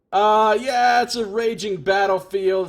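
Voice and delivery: loud sarcastic hype, starting high; hammed-up recruiter parody; mock sales pitch. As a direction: oversell it with mock enthusiasm.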